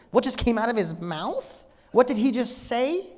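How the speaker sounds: high, rising questions of disbelief; incredulous. Acted. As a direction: voice a crowd that can't believe its ears. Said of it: "It was laugh out of absurdity".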